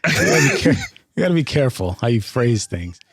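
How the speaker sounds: ordinary talk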